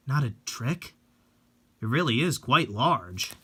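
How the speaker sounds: normal talking voice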